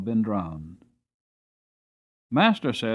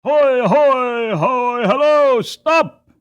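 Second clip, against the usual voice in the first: much higher, louder distant hail; crying out; 12 semitones higher, 11 dB louder, much slower than usual. Acted. Toll-gate man shouting to the cart across the bridge. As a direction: yell across the river to stop them. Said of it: "he cried".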